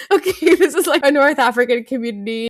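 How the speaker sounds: giggling as she explains; laughing. Real, not acted. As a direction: crack up while explaining. Laughs while explaining Steinway joke.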